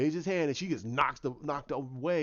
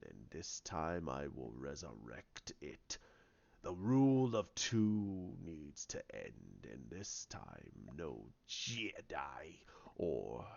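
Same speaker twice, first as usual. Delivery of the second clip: deep, slow, half-whispered villain rasp; Snoke's voice; 13 semitones lower, 12 dB quieter, slower than usual. Retold or imitated. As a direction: loom over him, low and menacing, breathing the words. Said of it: "He goes".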